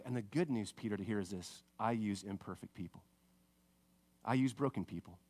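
low, soft, intimate voice; quietly restore a friend who failed; gentle, hushed sincerity, pitched low; tender reassurance. Acted. Jesus reassures Peter, acted.

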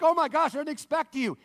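high, loud mock astonishment; startled surprise. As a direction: exclaim as if caught off guard. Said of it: "To Peter's surprise".

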